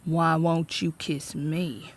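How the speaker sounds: slow, soft leering sheriff; drunk sheriff. A narrator's reading. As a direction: murmur it slowly and mockingly. Drunk sheriff taunting teacher.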